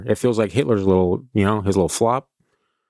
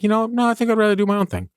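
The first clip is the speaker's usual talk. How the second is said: mock dismissive artist; 11 semitones higher, faster than usual. Artist voicing own preference.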